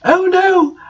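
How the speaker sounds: high, hammed-up panicked cry; scared shout. A narrator's reading. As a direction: wail it like a B-movie scream. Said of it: "I shouted in a scared voice".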